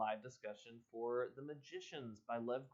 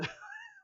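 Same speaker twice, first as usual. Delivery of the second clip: squeaky burst of laughter; short high giggle; 4 dB quieter than usual. Real, not acted. Laugh after surprise.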